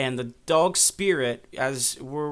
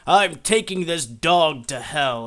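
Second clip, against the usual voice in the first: slow, louder villain line; villain threat; 6 dB louder, slower than usual. Retold or imitated. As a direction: menace the boy like a cartoon villain. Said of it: "and he says".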